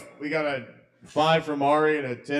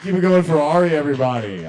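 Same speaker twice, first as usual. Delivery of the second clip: rally the crowd to applaud; rousing host call; 3 semitones higher, 7 dB louder, faster than usual. Real, not acted. Host asking the crowd to applaud Ari, rousing shout.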